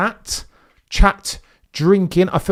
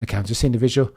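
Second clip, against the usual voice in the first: ordinary talk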